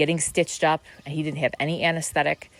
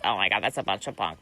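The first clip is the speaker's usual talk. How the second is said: much lower mock scoffing voice; scoffing logical mind; 7 semitones lower, faster than usual. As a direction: scoff like a dismissive skeptic. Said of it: "the logical mind's like".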